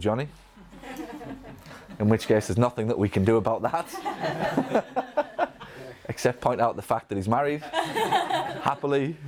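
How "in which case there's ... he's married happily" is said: laughing through the words; amused chuckle, stretching out happily; joking aside while laughing. Real, not acted. Joking about a love affair.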